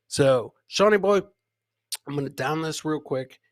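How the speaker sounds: ordinary delivery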